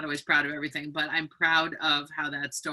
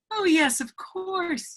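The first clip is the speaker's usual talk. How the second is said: much higher, honeyed reading of the quote; sugary welcome; 12 semitones higher, slower than usual. A narrator's reading. Author reading the neighbour's line aloud, sugary. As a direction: voice the neighbour's relieved fake sweetness. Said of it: "you say".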